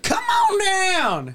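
huge drawn-out high shout; game show announcer. Retold or imitated. Friend mimics announcer, slow booming high call. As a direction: belt it like a game show host.

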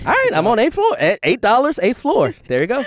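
high, loud excited cheer; excited shout. Real, not acted. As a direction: celebrate the eighth floor loudly. Excited reaction to auctioneer bit.

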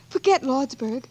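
ordinary talk

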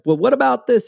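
high, anxious retold question; a hint of panic, self-imitated; mock worried question. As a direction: fret like a nervous patient. Anxious patient questioning the doctor.

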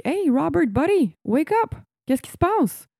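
high, urgent call to a coworker; alarmed calling. Retold or imitated. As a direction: try to wake him up. Coworker finds colleague unresponsive.

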